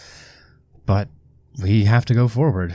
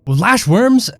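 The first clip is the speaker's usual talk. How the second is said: surprised; 13 semitones higher, 8 dB louder, slower than usual. Acted. Player reacts in disbelief.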